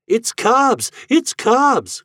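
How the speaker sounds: put-on high, loud joyful cry of a small boy; a little boy shouting with glee at seeing a friend; excited cry. A narrator's reading. Boy greets Cobbs at the inn, crying out in delight. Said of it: "cries Master Harry".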